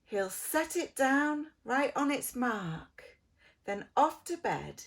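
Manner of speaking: ordinary delivery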